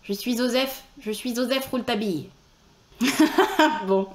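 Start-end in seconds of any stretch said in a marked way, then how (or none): 0.0-2.3 "Joseph" lisped with a z, as "Zozeph". Acted. Trying a lisping Joseph Rouletabille, "Joseph" said "Zozeph". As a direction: lisp on "Joseph", the j turned to z. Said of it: "Est-ce qu'on fait zozoter Joseph Rouletabille?".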